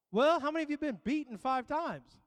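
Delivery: ordinary talk, nothing marked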